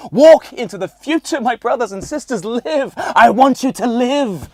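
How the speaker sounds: high, loud, hammed-up liberator shouting to the herd; rousing rallying cry. Man crossing the road exhorts those waiting at the lights, rousing high shout. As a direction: shout it like a mock revolutionary freeing the masses.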